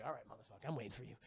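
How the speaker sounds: deep, soft, menacing growl; low Godzilla taunt. Retold or imitated. Godzilla quietly daring the monster to attack.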